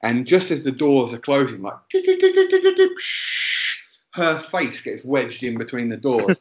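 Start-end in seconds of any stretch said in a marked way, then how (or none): 1.9-3.0 very high, loud rapid electronic beeping; door-closing beeps. Retold or imitated. Imitating train door closing beeps. 3.0-3.8 voiceless hiss of sliding doors; door-closing whoosh. Retold or imitated. Imitating train doors sliding shut.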